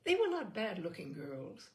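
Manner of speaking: ordinary delivery, nothing marked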